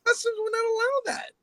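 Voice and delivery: high, strained retelling of her own words; telling a scam victim that customs blocks cash, high and tight; mock high voice. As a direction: squeeze it up high and tense. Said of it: "I said".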